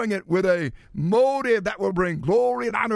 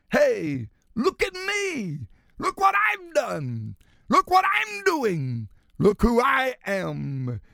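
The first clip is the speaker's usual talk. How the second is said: preen like a show-off craving attention; boastful flesh. Acted. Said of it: "The flesh cries out".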